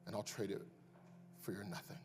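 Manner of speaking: gentle Jesus. Acted. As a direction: answer the doubter gently, with love. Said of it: "And he goes".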